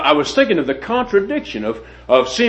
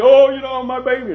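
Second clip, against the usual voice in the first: retold anguished cry, much higher; moaning plea; 11 semitones higher, 4 dB louder than usual.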